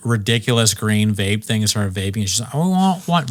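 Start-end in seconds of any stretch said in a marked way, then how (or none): 2.5-3.3 mock over-friendly. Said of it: "like, way too friendly".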